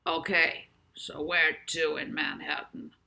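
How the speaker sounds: a hint of a gruff driver asking. A narrator's reading. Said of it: "another deeper voice inquired".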